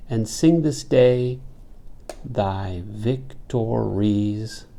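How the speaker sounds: "and sing this day thy victories" beat out in four feet, very slow at the end; iambic scansion. Shown as a demonstration. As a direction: pause after "day", then stretch "thy victories" syllable by syllable. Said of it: "that is iambic tetrameter".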